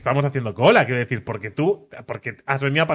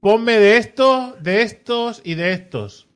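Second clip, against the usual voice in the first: drawn-out mock order, higher at first; slow pointing order; 7 semitones higher, much slower than usual. Customer dithering to baker, slow mock order. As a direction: point at each pastry slowly. Woman ordering pastries slowly.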